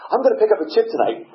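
put-on high, loud, quick girl's voice; imitated girl. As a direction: mimic a girl announcing it brightly to the room. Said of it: "this girl goes".

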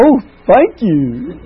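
slow, high, loud mock pride; proud thank you. Young drunk taking a jab as a compliment, slow proud thanks. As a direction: drag out a flattered thanks, tickled with himself. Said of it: "I'd say".

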